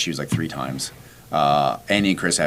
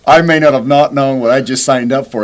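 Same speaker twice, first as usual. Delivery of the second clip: panicked; 6 semitones higher, 11 dB louder than usual. Retold or imitated. Inner panic at uncharted distance.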